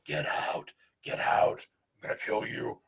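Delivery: hoarse ghostly whisper; creepy whisper. Retold or imitated. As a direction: breathe it like a spirit threatening. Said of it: "come across one of the boxes and go".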